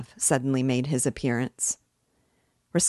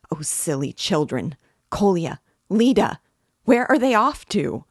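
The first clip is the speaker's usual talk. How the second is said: scolding that jumps to high, loud calls; alarmed cry; 3 semitones higher, 6 dB louder than usual. A narrator's reading. Mother calling after runaway children, frantic alarm. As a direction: call after them in panic. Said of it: "she cried suddenly in alarm".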